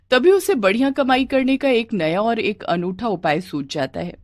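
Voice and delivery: ordinary delivery, nothing marked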